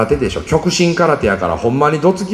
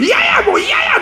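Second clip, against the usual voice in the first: put-on kid yelling. A boy yells back at his mother. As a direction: wail it out like a stubborn boy. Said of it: "大声でね".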